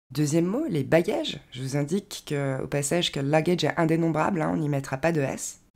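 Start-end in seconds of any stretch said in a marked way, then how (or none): none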